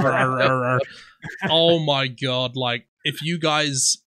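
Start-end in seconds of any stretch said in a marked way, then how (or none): 0.0-0.8 thick mock West Country mumble; mumbled farmer gibberish. Farmer in Hot Fuzz nobody understands, mock mumbled farmer accent. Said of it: "because he's like".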